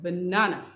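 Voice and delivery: "banana" with the middle "na" stressed, falling end; stress on middle syllable. Shown as a demonstration. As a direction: hit the second syllable of "banana". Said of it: "केवल बीच वाले को".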